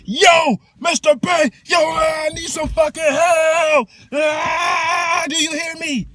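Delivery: put-on very high, loud shouting child, slow; yelling kid. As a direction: holler for help at the top of the voice. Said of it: "kids that's fucking yelling at me".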